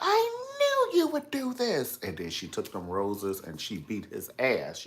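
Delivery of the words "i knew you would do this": high, slow, falsetto Carrie. Retold or imitated. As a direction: squeal it up high and slowly. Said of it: "she was like".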